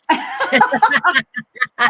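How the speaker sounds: bursts into a high, loud laugh; a real giggle breaking out. Real, not acted. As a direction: laugh at your own folly. Laughing at own story.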